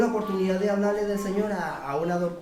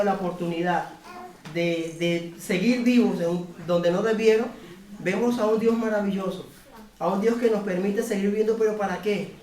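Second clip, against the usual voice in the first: rising fervent preacher's call, louder at the end; building sermon climax, pressing and louder; preaching; 3 semitones higher, 4 dB louder than usual. Acted. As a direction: proclaim it to the congregation. Closing exhortation to the congregation.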